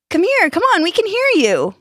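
high, loud beckoning; yelled welcome. A narrator's reading. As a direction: call newcomers over eagerly. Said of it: "laughing and yelling their own welcomes".

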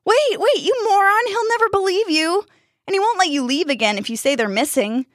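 high-pitched, agitated arguing; panicked protest. A narrator's reading. Jack stopping Dustin from running off, panicked.

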